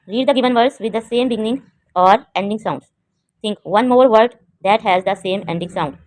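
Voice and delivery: very slow, word-by-word reading; deliberate, spaced-out classroom reading; slow textbook read-aloud. Shown as a demonstration. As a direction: read out instructions slowly for young learners. Reads textbook instructions aloud.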